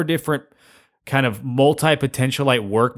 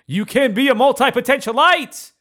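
excited; 11 semitones higher, 3 dB louder than usual. Acted. Hyping up the listener.

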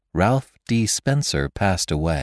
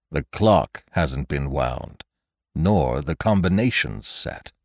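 groaning; 5 semitones lower, slower than usual. Acted. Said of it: "he groaned".

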